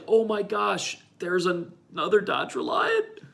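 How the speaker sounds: high astonished exclamation; amazed. Retold or imitated. Driver spotting an identical car, high and amazed. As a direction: gasp at the coincidence. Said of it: "And I was like".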